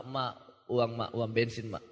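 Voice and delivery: low, soft wheedling for money; imitated child begging mother. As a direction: mumble a sheepish request to mum. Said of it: "Waktu itu kan dari orang tua".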